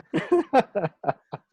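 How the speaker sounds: high burst of laughter; real amused laugh. Real, not acted. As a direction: crack up at the cheeky joke. Laughs at the innuendo.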